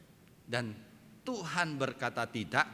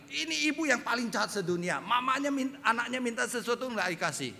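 high, scandalised neighbour's complaint; tut-tut like an outraged neighbour; acted neighbour's accusation, pitched up; indignant neighbour; 10 semitones higher, 4 dB louder than usual. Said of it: "tetangga bilang".

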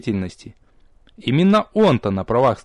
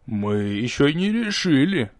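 slow, menacing villain; lightly voiced ominous threat; sinister voice; 2 semitones higher, slower than usual. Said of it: "зловещим голосом сообщил второй". (зловещим голосом)

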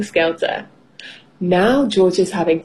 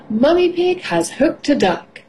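ordinary delivery, nothing marked

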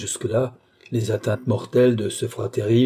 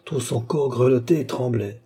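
ordinary delivery, nothing marked

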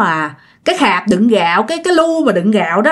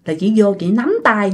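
plain reading voice

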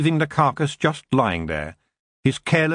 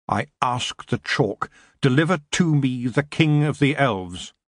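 ordinary delivery

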